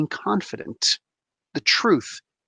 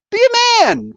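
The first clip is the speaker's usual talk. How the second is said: emphatic; 6 dB louder than usual. Real, not acted. Exhorting men, raised voice.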